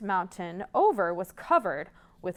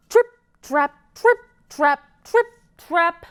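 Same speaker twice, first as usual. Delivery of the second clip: loud, high trip trap; big goat shaking the bridge; heavy hoof chant; 8 semitones higher, 10 dB louder than usual. Shown as a demonstration. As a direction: stomp it out like big hooves.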